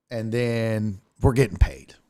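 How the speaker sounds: normal talking voice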